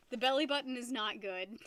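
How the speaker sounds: ordinary talk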